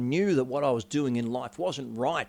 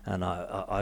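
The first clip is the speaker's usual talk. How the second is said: usual speaking voice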